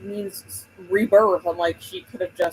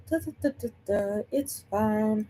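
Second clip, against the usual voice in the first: airy sing-song shrug of denial; mock nonchalance; 3 semitones higher, 7 dB quieter than usual. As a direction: hum it off breezily, pretending all is well. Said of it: "I was like".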